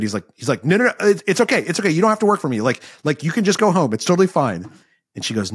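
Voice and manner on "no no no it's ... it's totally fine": higher-pitched, hurried mock panic; freaked out. Lawler telling a crying recruit to go home, freaked out. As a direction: act out a flustered, freaked-out reassurance. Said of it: "is so freaked out".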